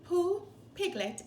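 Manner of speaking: ordinary delivery, nothing marked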